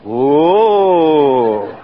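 long "whoa" rising then sliding down; re-enacted, drawn-out wail of amazement; joining the awed crowd. Young listener joining the crowd's reaction, imitated awed whoa. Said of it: "And I joined them".

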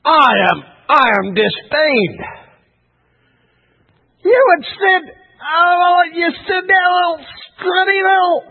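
roaring, drawn-out giant's disdain; Goliath. Retold or imitated. Preacher as the giant scorning David, hammed-up roar. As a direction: bellow in outrage, rising high.

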